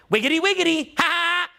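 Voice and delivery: high-pitched try-hard clowning; trying for a reaction. Acted. Speaker mocking someone fishing for a reaction, try-hard silly. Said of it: "trying to get reaction".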